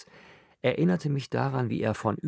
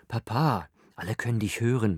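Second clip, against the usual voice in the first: plain reading voice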